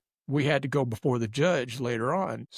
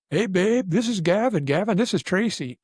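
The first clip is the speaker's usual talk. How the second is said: put-on louder, higher hearty call to a girlfriend; retold intro call; 6 semitones higher, 5 dB louder than usual. Rocker introducing his passed-out girlfriend, loud and cheerful. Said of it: "and he goes".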